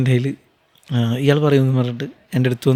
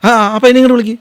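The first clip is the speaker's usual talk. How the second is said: loud, higher call to fetch someone; retold call across the set; 8 semitones higher, 7 dB louder, much faster than usual. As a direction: shout the order across the set. Crew calling him over on set.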